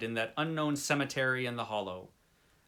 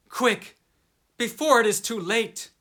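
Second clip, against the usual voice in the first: tense urgency; 9 semitones higher, 6 dB louder than usual. Acted. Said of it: "softer quality, as of hopeless resignation".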